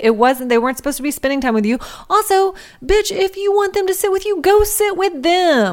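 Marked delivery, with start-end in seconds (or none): 2.1-5.7 exasperated. Pretend. Scolding absent character.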